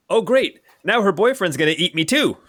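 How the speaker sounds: high, whiny goblin voice; exasperated gobloid. Acted. Gobloid despairing at the warrior's boyfriend, exasperated. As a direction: lament it high like a put-upon goblin. Gobloid laments being eaten.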